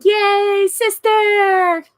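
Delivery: high, loud, delighted yay; squealing greeting; excited cheer. Real, not acted. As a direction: welcome a new sister. Viewer is adopted sibling.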